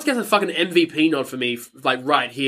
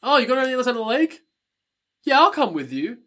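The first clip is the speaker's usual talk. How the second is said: higher and fast, breezy and carefree; imitating Jenna casually agreeing to tag along; breezy mock Jenna; 7 semitones higher, faster than usual. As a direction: toss it off like it's nothing. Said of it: "Jenna was like".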